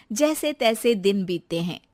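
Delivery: ordinary delivery, nothing marked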